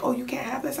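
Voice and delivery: high, innocent mock question; man asking about the sweets, mock innocent; imitating her man. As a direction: play him innocently clueless. Her man's reply in argument.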